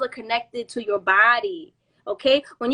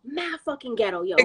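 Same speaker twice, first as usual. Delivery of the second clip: ordinary delivery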